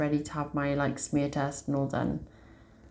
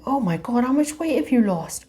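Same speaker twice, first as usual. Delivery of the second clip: high, louder astonished cry; amazed doctor; 7 semitones higher, 4 dB louder than usual. Retold or imitated. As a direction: mimic the doctor's shock at the weight loss. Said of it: "They were both freaking out".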